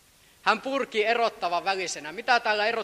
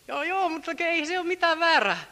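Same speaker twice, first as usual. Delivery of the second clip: mock Pilate; 9 semitones higher than usual.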